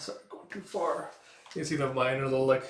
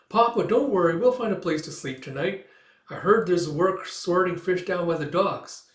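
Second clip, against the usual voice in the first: put-on high, keen young voice; hopeful child; 5 semitones higher, 4 dB louder than usual. Child telling Papa they will find work, hopeful and high. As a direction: pipe up brightly to cheer a parent.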